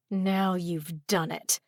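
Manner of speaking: seethe softly through gritted teeth; hissed through gritted teeth. A narrator's reading. Stung investigator to the pixie, hissing. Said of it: "I hissed through gritted teeth".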